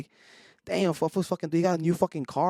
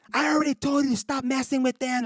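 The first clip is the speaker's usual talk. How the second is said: higher mock scream of an angry woman; loud retold scolding; 4 semitones higher than usual. Woman scolding a kid at Chuck E. Cheese, loud. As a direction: imitate her yelling at the kid. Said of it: "like, loud, loud way".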